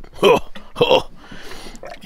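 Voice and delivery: short high bursts of laughter; two breathy hoots of amusement; laughing. Real, not acted. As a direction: laugh at your own joke. Laughs after joking about smells.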